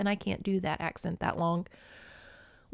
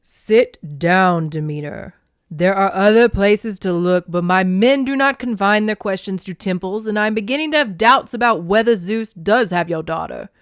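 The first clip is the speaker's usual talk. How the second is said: loud, commanding god, opening deep; big, authoritative character voice; Poseidon; 8 dB louder than usual. Acted. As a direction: boom it out like an imposing sea god. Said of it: "maybe he should have a deeper voice".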